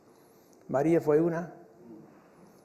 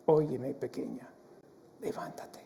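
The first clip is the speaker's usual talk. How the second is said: softer and softer, ending in a whisper; hushed tender Jesus voice; 4 semitones lower, 8 dB quieter than usual. Acted. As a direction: wake a sleeping child gently. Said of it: "mira la ternura de Jesús".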